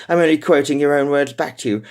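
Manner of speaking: sweet and needling; sweetly. Acted. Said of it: "she said sweetly".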